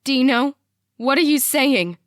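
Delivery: loud, high demand for an answer; insistent blurt. A narrator's reading. Mom demands dad explain himself, loud insistent blurt. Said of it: "Mom blurts".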